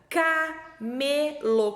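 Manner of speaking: "camelo" split slowly into ca, me, lo; very slow "ca-me-lo" with gaps; syllable by syllable. Shown as a demonstration. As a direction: sound out "camelo" in three long beats. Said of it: "Qual que é o primeiro sonzinho que eu falo?".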